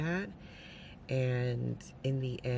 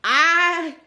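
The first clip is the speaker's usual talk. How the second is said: incredulous outburst; 12 semitones higher, 12 dB louder, slower than usual. Real, not acted. Reacting in disbelief to book character.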